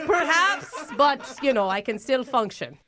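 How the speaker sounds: mock Irish accent, a touch drunk; hammed-up Irish drinker; tipsy fake Irish. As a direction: put on a tipsy Irish lilt. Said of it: "are you drunk right now?".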